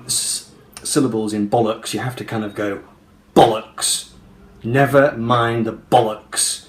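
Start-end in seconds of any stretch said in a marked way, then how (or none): 3.3-4.1 "bollocks" with a loud, explosive B and falling end; "bollocks" punched on the first syllable; attacked B. Shown as a demonstration. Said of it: "kind of attack the B". 4.6-6.6 "Never mind the bollocks" slowly, punching each stressed word; "Never mind the bollocks" in measured, falling beats; heavy downward stresses. Shown as a demonstration. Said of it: "gives it its kind of ba-dum".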